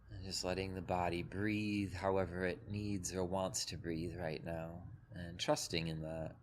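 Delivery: calm, even meditation voice with long pauses; guided meditation. Acted. Teacher leading a group through breath and body, guided meditation. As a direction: guide them gently into the body, leaving space between phrases.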